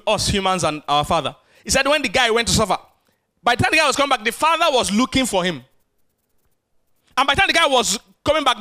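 ordinary talk

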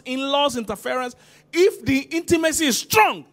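high pulpit declaration building to a loud peak; emphatic preaching. Real, not acted. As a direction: drive through the list, then ring out the condition. Preaches with rising force.

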